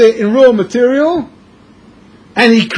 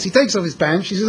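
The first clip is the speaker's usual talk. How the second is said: ordinary talk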